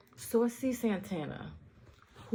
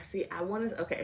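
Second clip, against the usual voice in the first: ordinary talk, nothing marked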